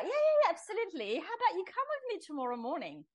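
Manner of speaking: high, fast, beaming yes-yes; cheerful Kiwi friend, quick and high; eager friend's invite. Retold or imitated. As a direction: voice the bubbly friend inviting her to church.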